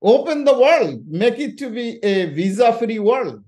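rally-cry demand for an open world; retold shout. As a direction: proclaim it like you're yelling at the queue. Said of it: "I was shouting at them".

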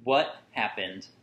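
usual speaking voice

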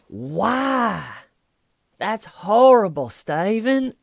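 very high, loud, stretched-out outcry; hammed-up appalled scolding; mock horror. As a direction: imitate a scandalised detective at full pitch. Imitates detective appalled at suspect.

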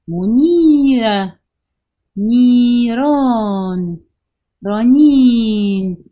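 منيرة, نيران, رنين very slow and loud, long vowels held; very slow, loud words with drawn-out vowels; stretched long vowels. Shown as a demonstration. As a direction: stretch every long vowel as far as you can. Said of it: "بمد وأطول فيها على قد ما بقدر".

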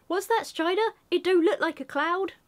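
a hint of a worried hobbit; Sam voice. Reading Sam's lines to Strider in character. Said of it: "Maybe my Sam voice needs work".

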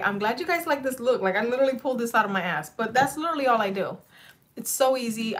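bubbly excitement; excited. Real, not acted. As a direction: gush about how easy the look was. Excited about her look.

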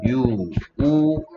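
유 and 우 drawn out slowly; slow phonics demo. Shown as a demonstration. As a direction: stretch out 유, 우 slowly as letter sounds. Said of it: "U는 세가의 소리".

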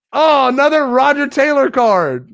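high, loud groan of disappointment; dismayed outburst. Real, not acted. Collector getting yet another unwanted card, dismayed outburst. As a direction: cry out high at the letdown.

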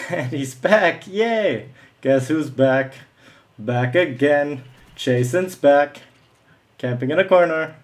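fake-cheer the camper's return; mock celebration. Real, not acted.

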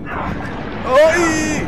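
very high sudden ay; mock yelp. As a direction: squeal as if burnt. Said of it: "Me quemo". (grito agudo fingido de quemarse)

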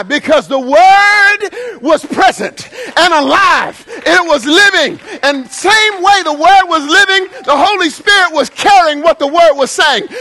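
loud, fervent preacher's build to climax; full-force preaching. Acted. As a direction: drive it home to the congregation.

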